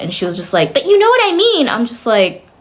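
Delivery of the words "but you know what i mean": high-pitched mimicry of a pleading friend; mock insistence. Friend defending her remark to the speaker, mockingly insistent.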